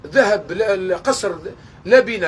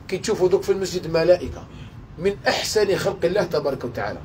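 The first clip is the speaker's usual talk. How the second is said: usual speaking voice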